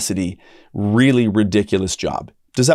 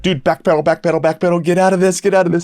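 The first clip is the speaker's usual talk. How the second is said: mock panic; 7 semitones higher, faster than usual. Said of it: "a lot of people would be like".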